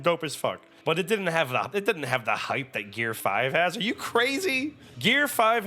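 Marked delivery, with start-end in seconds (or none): 3.8-5.7 high, disbelieving retort; incredulous. Real, not acted. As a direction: toss it back like they've lost their mind.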